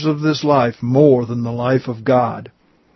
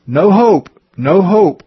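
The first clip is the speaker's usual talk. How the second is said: desperate cry; 5 semitones higher, 4 dB louder than usual. A narrator's reading. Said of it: "he called".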